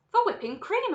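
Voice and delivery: high, loud answer; emphatic retort. Acted. Wonka answers Veruca's question, emphatic and loud. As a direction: state the obvious with gusto.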